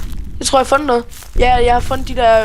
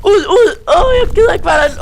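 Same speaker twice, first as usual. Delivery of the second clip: flee screaming, voice cracking in fear; panicked screaming; 10 semitones higher than usual. Real, not acted. Gamer chased by Slender, shrieking in real terror. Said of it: "jeg tror lige, jeg skreg".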